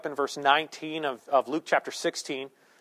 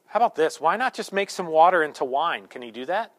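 ordinary talk

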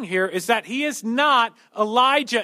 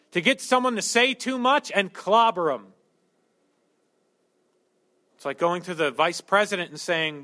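ordinary delivery

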